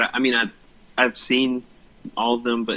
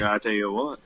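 put-on country accent; 3 semitones lower, 4 dB quieter than usual. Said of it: "in my country voice".

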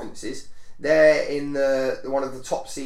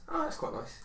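mock mild reaction; 13 semitones higher, 11 dB quieter than usual. Imitating own mild reaction.

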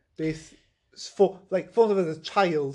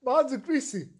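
put-on high, fast, laughing Italian-accented wail; hammed-up Italian accent in despair; quick mock Italian lament; 8 semitones higher than usual. Acted Earl in distress.

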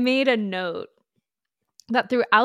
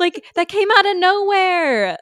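high, loud disbelief; incredulous; 8 semitones higher, 5 dB louder than usual. Real, not acted. Co-host reacts to Jasper's sudden backstory, incredulous. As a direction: exclaim it higher and louder, baffled.

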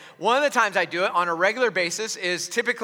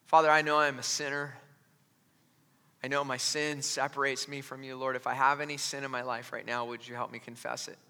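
quiet prayer; 9 semitones lower, 9 dB quieter than usual. Retold or imitated. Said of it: "I just".

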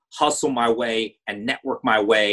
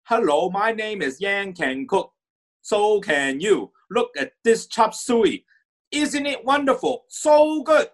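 put-on high, slow Cantonese-accented cooking-show voice; Uncle Roger accent; 10 semitones higher, slower than usual. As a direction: do a hammed-up Uncle Roger impression. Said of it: "but he spoke like Uncle Roger".

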